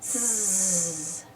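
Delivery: soft, low drawn-out "zzz"; cartoon snoozing sound; sleeping buzz. A narrator's reading. Travis answering while fast asleep, soft snoozing buzz. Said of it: "Travis replied, fast asleep".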